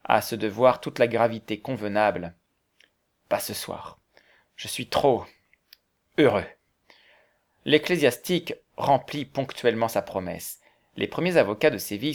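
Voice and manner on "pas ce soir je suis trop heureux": hushed, halting reply with long pauses; overcome with emotion. A narrator's reading. Valberg declining the prayer, too moved, hushed and halting. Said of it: "son émotion était trop vive".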